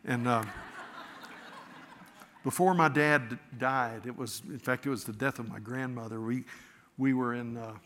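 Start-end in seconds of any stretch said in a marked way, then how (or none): 0.5-2.2 breathy laugh. Real, not acted. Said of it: "so you'll believe me".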